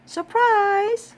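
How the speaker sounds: high, loud gleeful shout; shouted surprise. A narrator's reading. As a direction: yell it all together. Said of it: "shouted Amelia Bedelia".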